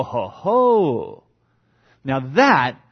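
ordinary talk

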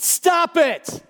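high, sharp yell of command; yelled rebuke. Acted. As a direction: rebuke the storm with a shout. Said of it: "He yelled at them. He rebuked them".

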